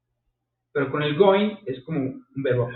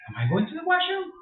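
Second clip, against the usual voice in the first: squeaky high mock question. Shown as a demonstration. Teacher teasing students with a high mock question. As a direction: pitch it way up, playfully shocked. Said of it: "No, yo no".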